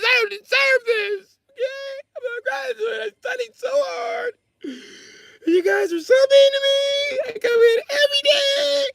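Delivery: very high, sobbing whiny lady voice; hammed-up teacher breaking down in tears.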